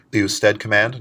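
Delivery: usual speaking voice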